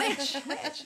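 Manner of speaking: high, loud mock accusation through laughter; mock yell. Friend joking about being called names, laughing mock yell. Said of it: "nobody's yelled at me".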